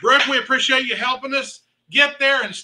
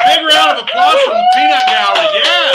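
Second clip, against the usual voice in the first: much higher and louder, calling out to the room; rally the crowd for applause; 7 dB louder than usual. Real, not acted. Auctioneer asks the audience to clap, loud announcing.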